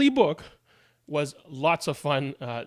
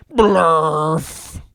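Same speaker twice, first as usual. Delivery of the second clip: comic nonsense word, louder; silly nonsense word; 6 dB louder than usual. Acted. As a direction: blurt out a silly made-up word. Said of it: "Wait a second, what?".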